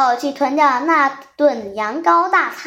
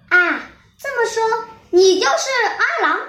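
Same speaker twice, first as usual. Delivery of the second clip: taken aback; 8 semitones higher than usual. A narrator's reading. Mother sheep realising the wolf is the letter writer, startled. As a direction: gasp it out as the truth dawns. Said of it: "羊妈妈大吃一惊".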